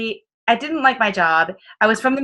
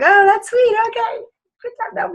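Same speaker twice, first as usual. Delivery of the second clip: much higher, sugary dismissive voice; mock sweet brush-off; 13 semitones higher than usual. Niece brushing off her nosy uncle, mock sweet.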